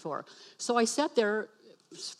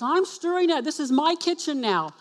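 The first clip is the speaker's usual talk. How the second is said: higher, louder mock husband retort; exasperated retort; 5 semitones higher, 5 dB louder than usual. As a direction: imitate an indignant husband snapping back.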